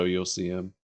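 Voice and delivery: ordinary talk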